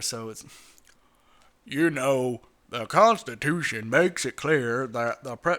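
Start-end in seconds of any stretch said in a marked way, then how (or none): 1.6-5.6 low, slow, lightly put-on Boehner voice; a hint of a gruff politician; Boehner impression. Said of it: "This is John Bonner we're talking about".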